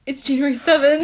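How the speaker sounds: sudden loud shout. Real, not acted. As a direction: startle her with a shout. Shouted prank scare.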